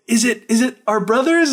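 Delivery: high, halting excited question; mock hopeful. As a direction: imitate breathless hope after a gasp. Wife hoping rescue is coming.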